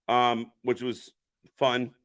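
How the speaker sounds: ordinary delivery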